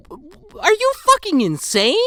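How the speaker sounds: incredulous. Retold or imitated. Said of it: "as I'm sitting there like".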